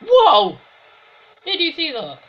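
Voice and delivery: loud high startled whoa; surprised shout. Real, not acted. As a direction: jolt up in shock. Sudden shock at play.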